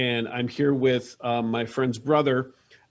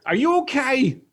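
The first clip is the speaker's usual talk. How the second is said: shrill mother; 11 semitones higher, 4 dB louder than usual. Acted.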